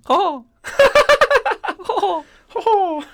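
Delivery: high squeaky laughing Ho; Mickey Mouse. Retold or imitated. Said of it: "Just Mickey Mouse".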